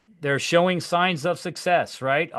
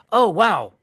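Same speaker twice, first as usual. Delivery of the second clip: mildly amazed, higher-pitched reaction; impressed; 6 semitones higher, 4 dB louder than usual. Acted. Agent admiring a seller's family photo, impressed. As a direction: act pleasantly impressed.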